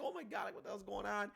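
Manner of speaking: high-pitched imitated alarm; mock panic. Reviewer voicing Yamato running away from the blast, mock panic. Voices character fleeing the blast.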